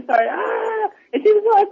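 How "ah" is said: high, held mock squeal; thin fan scream without full volume; imitated crowd scream. Everybody screaming at Will on the tour, mock scream. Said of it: "everybody started screaming".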